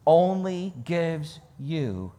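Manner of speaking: usual speaking voice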